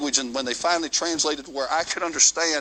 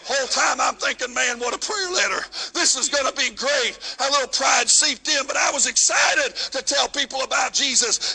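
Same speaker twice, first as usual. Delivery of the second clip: full-force preaching; 9 semitones higher than usual. Acted. Preacher telling the congregation his mission story, preaching at full force. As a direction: preach it out to the congregation, riding the music.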